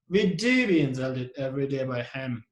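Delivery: "do" stretched and stressed, then falling away. Shown as a demonstration. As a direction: lean on the word "do". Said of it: "we put a stress on do".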